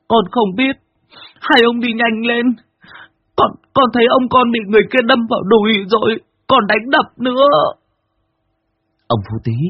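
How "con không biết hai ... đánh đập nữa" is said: put-on high, pleading child voice; frightened child. Boy Tèo urging the men to hurry, high and pleading. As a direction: beg them to hurry.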